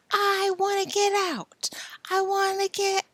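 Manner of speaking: high, whispered wailing; a faint cry from behind a door; whispered plea. Acted. Billy pleading behind the door, whispered.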